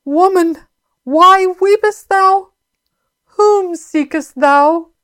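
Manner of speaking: high, slow, drawn-out words of Jesus; impassioned scripture reading. Acted. Preacher voicing Jesus questioning weeping Mary, high impassioned reading. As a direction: read it aloud high and stretched, full of feeling.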